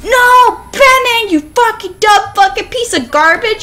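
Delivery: loud, high-pitched cursing rant; furious gamer outburst; real rage. Real, not acted. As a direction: explode at your useless character. Rage at own game character.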